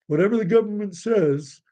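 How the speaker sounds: mocking the obedient public; mock sheep voice. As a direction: parrot them with scorn. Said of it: "most of the population".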